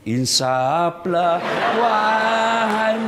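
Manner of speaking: slow, drawn-out preachy plea; mock pious plea. Preacher mocking a wife's plea to her angry husband, slow and drawn. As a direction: stretch Insaflah out like a sermon.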